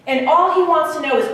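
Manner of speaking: ordinary delivery, nothing marked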